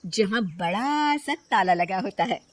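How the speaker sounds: plain reading voice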